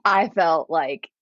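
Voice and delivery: plain reading voice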